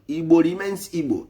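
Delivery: ordinary talk, nothing marked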